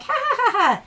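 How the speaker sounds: high mock laugh; imitating her laugh. The rep laughing at her again, high mock laugh. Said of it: "she's like".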